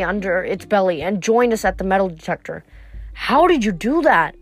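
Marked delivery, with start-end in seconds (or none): none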